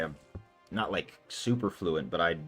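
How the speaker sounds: ordinary delivery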